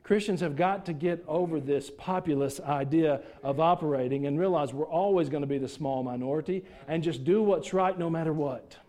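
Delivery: ordinary talk, nothing marked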